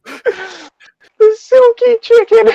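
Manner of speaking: stretched, very high, loud cry of the title; mock dramatic cry. Reading the odd game title aloud, hammed-up high wail.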